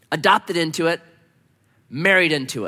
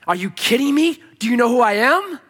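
high, loud, incredulous challenge; acted indignation, pitch jumping up; indignant disbelief; 7 semitones higher, 5 dB louder than usual. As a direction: throw it out as a proud challenge. Acting a proud child of the King.

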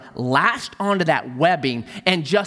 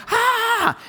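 sudden high yelp of alarm; a sharp mock scream; yelping in fright; startled yelp. Crowd shouting as snake thrashes.

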